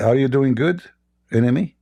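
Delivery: usual speaking voice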